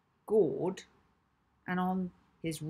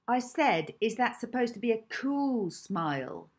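insistent, high-pitched repeated taunt, falling at the end; Hazel; 5 semitones higher, 3 dB louder than usual. A narrator's reading. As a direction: press the jeering question, leaning on cool. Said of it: "repeated Hazel".

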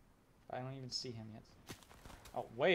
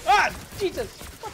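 startled yell of Jesus; sudden loud jolt of fright; alarm shout; 17 dB louder than usual. Real, not acted.

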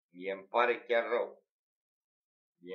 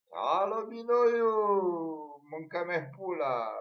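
mock caller shout; 7 semitones higher, 7 dB louder than usual.

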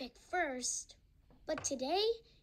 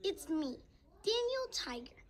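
ordinary delivery

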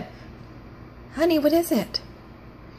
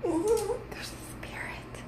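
mock spooked whisper; 7 semitones higher, 12 dB quieter, much slower than usual. Hushed spooked about spirit.

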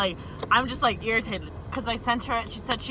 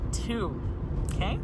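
usual speaking voice

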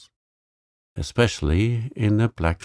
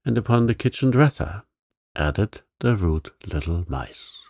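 plain reading voice

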